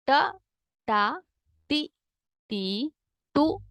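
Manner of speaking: ordinary delivery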